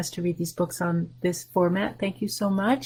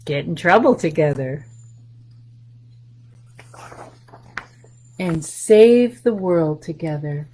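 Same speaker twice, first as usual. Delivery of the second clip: put-on reading to children. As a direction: read it warmly to little listeners, letting each line land. Reads picture book to children.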